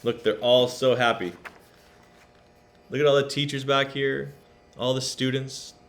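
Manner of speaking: usual speaking voice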